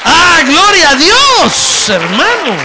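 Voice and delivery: loud, high, slow cry of praise; drawn-out preacher's shout of glory. Real, not acted. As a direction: burst out praising God at full voice. Preacher's ecstatic praise outburst.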